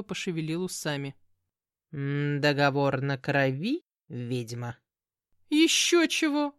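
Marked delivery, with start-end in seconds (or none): 1.9-4.8 low, slow sly voice; sly demon cat. Acted. 5.5-6.5 high, loud indignant outburst. A narrator's reading. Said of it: "возмутилась".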